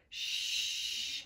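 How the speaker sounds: "Shh" as one long unvoiced hiss; digraph sound. Shown as a demonstration. Teacher showing the digraph, "Shh" held long. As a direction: hold "Shh" out, no vowel. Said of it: "It's a digraph".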